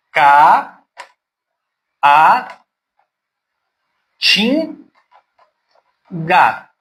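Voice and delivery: "ca, a, tin, ga" split into separate beats; syllable by syllable. Shown as a demonstration. Teacher to pupils, splitting caatinga syllable by syllable. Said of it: "Vou dar o exemplo da palavra caatinga".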